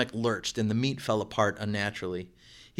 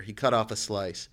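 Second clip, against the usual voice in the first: ordinary delivery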